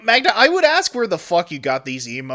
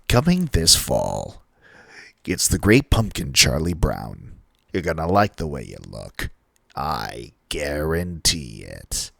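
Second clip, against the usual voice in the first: much deeper, slow commercial announcer voice; Men's Warehouse voice; 14 semitones lower than usual. Retold or imitated. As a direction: lean into the mic like a seventies ad voiceover. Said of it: "giving the Men's Warehouse voice".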